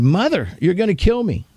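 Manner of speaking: high mock scream of a scared child; retold scream. Preacher retelling his childhood spanking, high mock scream. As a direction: cry it out as the frightened boy again. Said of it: "Now, when I screamed".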